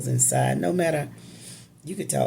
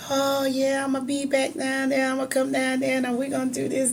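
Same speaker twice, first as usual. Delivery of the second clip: retold eager promises, much higher and quick; dad's eager promise; 10 semitones higher than usual. Dad promising relatives he'll come down, eager. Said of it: "dad on the phone".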